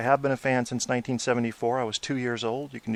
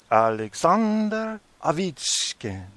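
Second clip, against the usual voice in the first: slow, higher Russian-accented "Alexander Ovechkin"; 5 semitones higher, slower than usual. Shown as a demonstration. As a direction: say the name slowly like a Russian would. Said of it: "That's how they say it in Russia".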